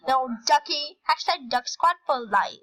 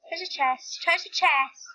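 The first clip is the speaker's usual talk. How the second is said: normal talking voice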